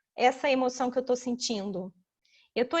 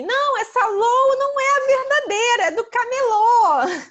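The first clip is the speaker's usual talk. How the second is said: high, loud bawling kid impression; complaining child; 10 semitones higher, 11 dB louder than usual. Child complains the doll is fake, high loud wail. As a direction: wail it like a child, stretching the end. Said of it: "já vi uma criança falando assim".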